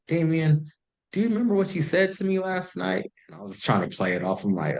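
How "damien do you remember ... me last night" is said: pitched up, pointed question; a lightly voiced girlfriend pressing him; voicing her. Calling him the next day, lightly voiced and higher.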